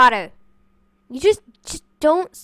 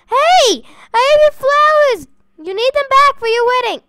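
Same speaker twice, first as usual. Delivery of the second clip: calling out; 7 semitones higher, 4 dB louder than usual. Real, not acted. Chasing villager to return flowers.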